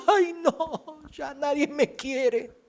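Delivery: high, slow mock whimper with a laugh; hammed-up crybaby voice, laughing; mocking a fragile youngster with a high, whiny, laughing wail; whiny mock victim.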